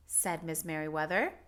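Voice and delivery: usual speaking voice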